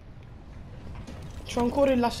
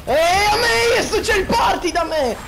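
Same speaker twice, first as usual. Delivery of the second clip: panicked shout; 8 semitones higher, 4 dB louder than usual. Real, not acted. Zombies swarming, yelling out.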